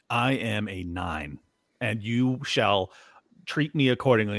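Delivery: slow, stately mock-grand proclamation; self-important. Mocking Liberty Twitter women with outsized egos, self-important.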